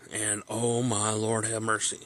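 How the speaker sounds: ordinary delivery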